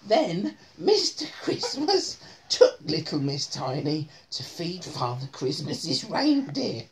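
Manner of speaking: usual speaking voice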